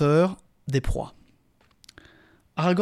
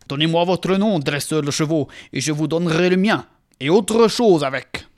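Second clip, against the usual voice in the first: commanding; 3 semitones higher, 6 dB louder than usual. Acted. Éomer challenges the strangers.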